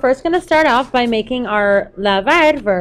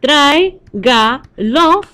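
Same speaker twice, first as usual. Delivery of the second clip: "Tráigalo" very slowly in three parts, trái, ga, lo; syllable by syllable; 3 semitones higher, 4 dB louder, much slower than usual. Shown as a demonstration. Teacher counting syllables for students, slow and separated. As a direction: count out the syllables of "Tráigalo", pausing between each. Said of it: "you can count the syllables".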